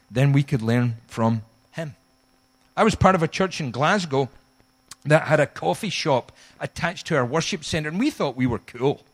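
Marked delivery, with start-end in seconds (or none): none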